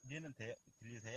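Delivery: barely audible phone line; faint connection. Real, not acted. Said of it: "안 들려, 안 들려". (멀리서 작게 들리는 통화 소리)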